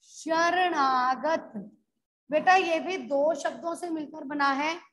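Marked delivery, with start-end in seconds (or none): 0.0-1.5 word drawn out very slowly; slow dictation. Shown as a demonstration. Teacher giving students a word to learn, very slow dictation. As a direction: stretch it syllable by syllable for the class.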